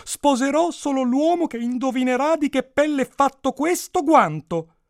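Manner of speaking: high, loud princess voice; theatrical princess decree; proclaiming princess. A narrator's reading. Princess sets her condition.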